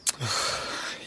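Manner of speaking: long tired sigh; weary sigh. Acted. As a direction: sigh out in defeat. Nephew sighs before reply.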